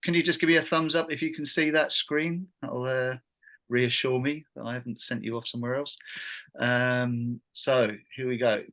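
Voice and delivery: usual speaking voice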